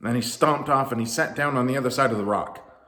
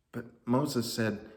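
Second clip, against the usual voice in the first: ordinary delivery, nothing marked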